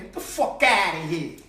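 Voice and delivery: loud New York shout from the gut; New York accent, "outta" belted. Retold or imitated. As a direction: holler it like a New Yorker. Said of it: "New York people talk like that".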